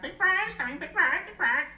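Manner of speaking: high, loud squawking bird; squawking parrot. Acted. Parrot squawking a warning to the chimpanzee.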